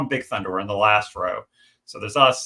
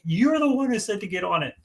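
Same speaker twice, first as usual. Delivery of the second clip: much higher-pitched mimicked comeback; imitated indignant retort; 10 semitones higher than usual. Wife answering her complaining husband on the ride, mock indignant. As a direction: mimic a wife throwing the blame back, high.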